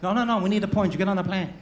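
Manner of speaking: high, loud hammed-up wife voice; imitated wife. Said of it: "wife voiced in story".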